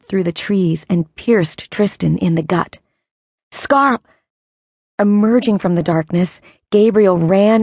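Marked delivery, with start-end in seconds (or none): 3.5-4.0 calling out. Acted. Brother shouting, cut off.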